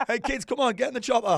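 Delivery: put-on high, loud laughing Scouse kid voice; mock Scouse kids. Podcast host jokes about kids' helicopter in Scouse accent. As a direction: ham up a Liverpool kid calling. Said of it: "It's your kids in a Scouse accent".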